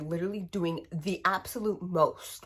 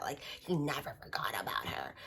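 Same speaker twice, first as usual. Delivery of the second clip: mock swoony whisper; 5 semitones lower, 7 dB quieter than usual. Mocking book's romance.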